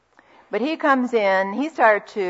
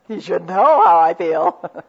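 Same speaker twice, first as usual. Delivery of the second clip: slow, louder, a touch huffy; mock indignant; 2 semitones lower, 8 dB louder than usual. Friend explaining why she won't ask husband, mock huffy. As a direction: lay on a wounded pride. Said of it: "What do you think she said".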